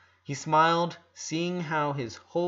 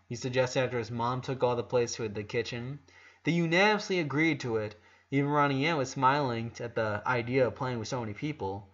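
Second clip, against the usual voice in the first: usual speaking voice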